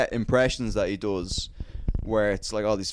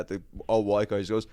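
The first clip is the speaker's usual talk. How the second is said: ordinary talk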